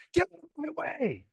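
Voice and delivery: high mock scream, held back in volume; strained high yell without full volume; retold yelling. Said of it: "she starts yelling at him".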